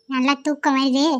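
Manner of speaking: ordinary delivery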